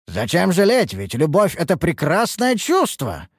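high, buoyant reassurance; jaunty, self-satisfied exclamation; cheerful and pleased. A narrator's reading. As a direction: gush happily about love. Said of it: "жизнерадостно заметил довольный Харан".

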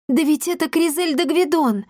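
put-on high-pitched girlish astonishment; amazed. A narrator's reading. Girl spotting someone she knows in the crowd, amazed. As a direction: blurt it out in surprise, voice jumping up. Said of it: "изумилась девочка".

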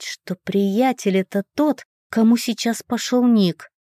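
ordinary delivery

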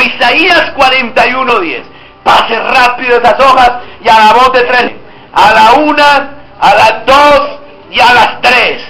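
normal talking voice

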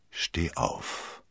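soft, voiceless and tender; infinitely gentle. Acted. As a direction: say it gently, barely aloud. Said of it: "sagte Felton unendlich sanft".

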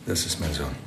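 low, hushed, barely holding together; quiet grief. Acted. Father identifying his son to the inspector, low and grief-struck. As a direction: say it as if it costs you everything.

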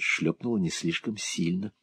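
usual speaking voice

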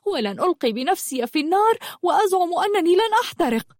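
high, rushed refusal; indignant protest. Acted. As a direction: protest hotly, pitch climbing. Girl protests she is no warrior. (احتجاج غاضب بنبرة متصاعدة)

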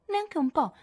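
acuto e beffardo; sfida. Acted. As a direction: nega con aria di sfida. Amy nega con sfida. (Amy nega a Jo con sfida, molto più acuta)

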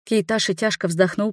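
plain reading voice

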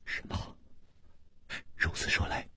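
breathless whisper of shock; stunned whisper. Acted. Li Yuhan reacts in a stunned whisper to Chu Liuxiang. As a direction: barely voice the words.

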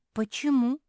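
high, loud puzzled question; bewildered. A narrator's reading. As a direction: ask it high, at a loss. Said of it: "растерялась Мафи".

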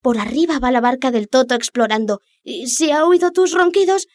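high, urgent fish voice; urgent little fish. Acted. Little fish warns the Rex.

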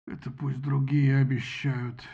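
медленное низкое ворчание; проворчи с тяжёлым вздохом; ворчливо. A narrator's reading. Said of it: "проворчал Зевс". (ворчит басом медленно)

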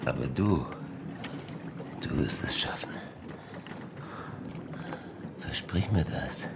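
very low, hushed, halting; dying castaway begging for a promise; weak, urgent plea. Acted. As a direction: whisper it with the last of your strength.

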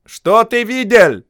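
высокий громкий окрик, медленно; кричит с балкона пленнику. A narrator's reading. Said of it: "крикнул с балкона офицер". (окрик с балкона)

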